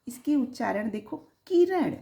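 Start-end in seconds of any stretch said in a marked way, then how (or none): 1.5-2.0 किरण with a short, quick first i; quick short vowel. Shown as a demonstration. Teacher demonstrates the short i in a word, quick short vowel. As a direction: say किरण briskly, no lingering on the i. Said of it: "झट से किया जा रहा है".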